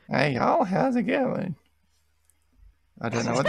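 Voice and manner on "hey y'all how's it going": put-on greeting that shoots up high like a breaking teenage voice; pubescent voice crack. Trying out y'all on friends, pubescent squeak. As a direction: let the voice leap up and crack on a playful greeting. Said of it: "Did you just hit puberty or something?".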